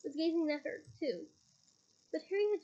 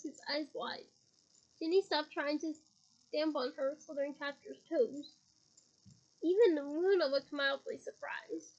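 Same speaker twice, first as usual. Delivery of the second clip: usual speaking voice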